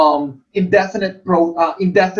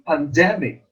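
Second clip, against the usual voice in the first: "pandemic" as pan-DEM-ic; teacher models "pandemic", middle syllable accented; second-syllable stress; 2 semitones lower than usual. Shown as a demonstration. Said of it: "the second syllable is accented".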